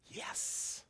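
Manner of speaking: a breathy whispered yes. Real, not acted. Preacher answering the verse himself in a whisper. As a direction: breathe the word out, voiceless. Quiet affirmation after scripture.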